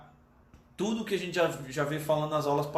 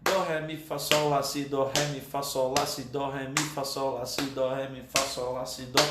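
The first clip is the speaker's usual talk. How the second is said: rapid, even run of note names; four notes per beat; 4 semitones lower than usual. Shown as a demonstration. Teacher moving up to four notes per beat, quick rhythmic recitation. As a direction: rattle off the notes in strict rhythm without stopping. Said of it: "passa pra quatro notas por tempo".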